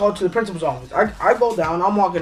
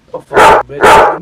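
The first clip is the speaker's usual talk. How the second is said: angry hiss; 11 dB louder, much slower than usual. Retold or imitated. Told he gets a zero.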